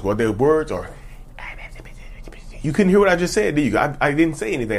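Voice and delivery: normal talking voice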